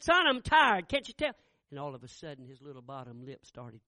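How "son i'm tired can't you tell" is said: loud, high, impatient shout; father losing patience, loud and high; exasperated yell. Retold or imitated. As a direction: make him back off. Father yells at son.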